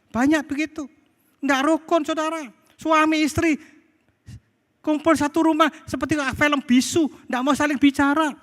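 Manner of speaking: high, insistent sermon voice; emphatic preacher's pitch. Real, not acted. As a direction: press the point on the congregation in a high voice. Preaches with raised voice.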